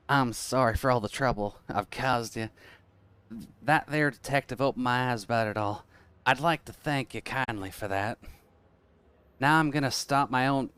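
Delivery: put-on Southern twang; Southern accent. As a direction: apologize like a folksy Southerner. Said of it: "trying to act like Southern accent".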